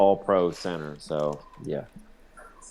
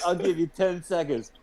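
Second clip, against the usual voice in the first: higher, mock tough-guy warning; kangaroo threat; 8 semitones higher than usual. Kangaroo warning the man before the fight, higher mock threat. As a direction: play the kangaroo counting him down. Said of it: "The kangaroo was like".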